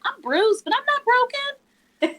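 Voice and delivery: high, triumphant retelling of her own words; defiant self-encouragement; emphatic self-talk. Retold or imitated. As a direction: lift it up high like a declaration of victory. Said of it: "And then I said".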